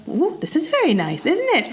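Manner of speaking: high, rising approval; lightly voiced husband admiring a new home; pleased husband. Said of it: "and he's like".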